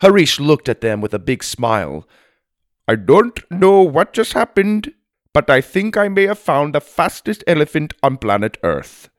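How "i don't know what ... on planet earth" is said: put-on higher, lively Indian-accented character; amazed Indian elephant rider. A narrator's reading. Elephant rider amazed at the speed, Indian-accented voice. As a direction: marvel aloud in an Indian accent.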